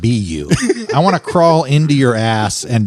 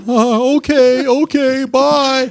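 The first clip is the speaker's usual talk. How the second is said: very high, whimpering goodbye; hammed-up meek whimper; mock tearful surrender; 11 semitones higher, much slower than usual. Husband retelling being kicked out by wife, mock tearful.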